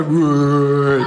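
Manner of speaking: a loud, far-off laddish whoa; lad noise whoa. Retold or imitated. Mate James making his lad noise, loud whoa. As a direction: whoop it like a lad on a night out. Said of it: "Makes the lad noise all the time".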